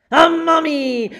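high, loud announcement; excited reveal. A narrator's reading. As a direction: shout out the reveal. Mummy reveal in story.

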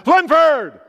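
booming call of a name, high and very loud; God's loud call. Retold or imitated. Pastor retelling God calling his name in prayer, a booming shout. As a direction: call it out like a voice from heaven. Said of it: "That loud".

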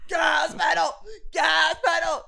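high, loud hype shout; shouted song hook. Acted. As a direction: belt out the hook like a hype man. Performs the remix hook.

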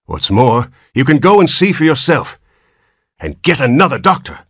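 furious dismissal; doctor angrily disowns the Button family; angry rebuke. Acted.

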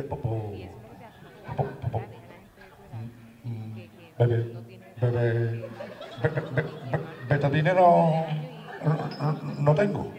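lightly voiced nasal pharmacist, stammering; nasal stutterer. Pharmacist who also speaks nasally answers, light stammer. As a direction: stammer out the refusal, mildly nasal. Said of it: "que también era gangoso".

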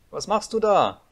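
put-on high, loud boyish call. A narrator's reading. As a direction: shout up to someone far above. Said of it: "rief der kleine Häwelmann hinauf". (laut hinaufrufen)

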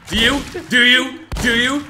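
high, loud, demanding; loud pressing question. Real, not acted.

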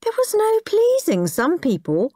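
high-pitched huffy complaint; indignant. A narrator's reading. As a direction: huff like an offended child. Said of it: "thought horrid Henry indignantly".

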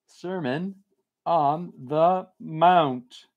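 Mount stretched out very slowly, as if writing; slow dictation. Shown as a demonstration. Reads the request aloud while writing it down, very slow. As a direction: drawl Sermon on the Mount out while jotting it down. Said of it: "I'm gonna make a note".

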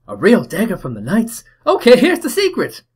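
put-on very high, loud Cockney boy bursting with joy; excited Cockney kid. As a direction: squeal it like a thrilled Cockney urchin. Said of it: "my best Cockney accent".

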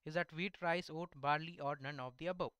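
normal talking voice

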